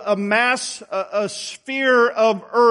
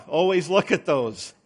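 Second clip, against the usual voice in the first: normal talking voice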